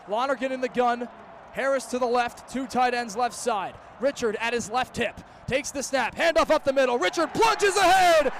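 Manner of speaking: normal talking voice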